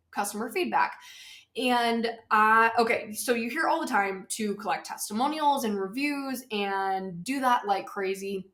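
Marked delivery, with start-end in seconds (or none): none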